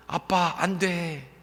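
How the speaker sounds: slow, drawn-out plea; pleading. Retold or imitated. Big daughter grabbing dad's arm, slow childlike plea. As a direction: stretch it out like a begging child. Daughter stops father's hand.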